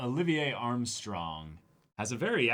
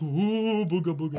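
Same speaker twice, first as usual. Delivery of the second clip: mock spooky ghost; 6 semitones higher, 6 dB louder than usual. Spooky joke about the general.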